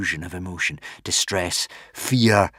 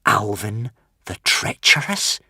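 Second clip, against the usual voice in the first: usual speaking voice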